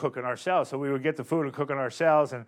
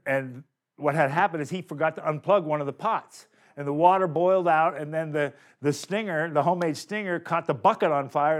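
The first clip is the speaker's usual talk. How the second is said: normal talking voice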